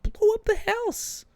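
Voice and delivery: a squeaky high mock command; mock robot order. Mocking the Autobots, a high squeaky order.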